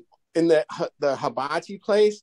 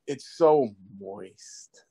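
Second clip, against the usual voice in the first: suggestive; 8 semitones lower, much slower than usual. Retold or imitated. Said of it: "And I'm like".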